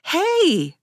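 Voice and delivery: high, startled "Hey!"; surprised yell. A narrator's reading. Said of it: "she yelled, more out of surprise than fear".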